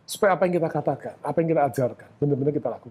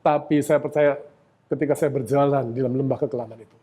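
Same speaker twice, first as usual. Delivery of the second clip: ordinary talk, nothing marked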